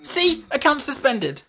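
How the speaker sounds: high, loud exasperated outburst; outraged. Real, not acted. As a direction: blurt it out in disbelief. Outraged at suspended account.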